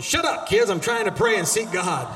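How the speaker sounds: loud, high-pitched parental yell, hammed up; mock exasperated shout. Preacher re-enacts interrupted prayer, yelling at his kids.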